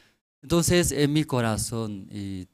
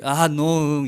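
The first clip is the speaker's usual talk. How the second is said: raised, pleading mock cry; a child's tearful protest, acted out; imitated crying; 5 semitones higher, 5 dB louder than usual. (protesta imitando llanto)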